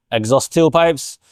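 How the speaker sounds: ordinary talk, nothing marked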